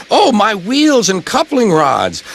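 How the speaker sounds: self-pitying engine about to cry; close to tears. A narrator's reading. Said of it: "Thomas began to cry".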